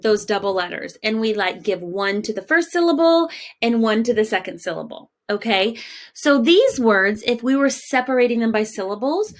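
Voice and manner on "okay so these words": ordinary delivery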